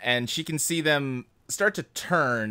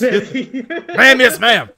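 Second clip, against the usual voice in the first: loud, higher military response; barking soldier; 10 semitones higher, 10 dB louder, much slower than usual. Acted. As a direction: bark it like an obedient recruit. Acting soldier answering an order.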